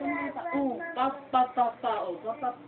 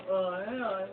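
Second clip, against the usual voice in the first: ordinary delivery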